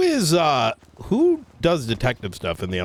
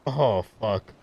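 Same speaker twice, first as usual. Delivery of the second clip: normal talking voice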